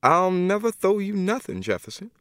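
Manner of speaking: a godmother drawing the line; Miss Emma refusing. Acted.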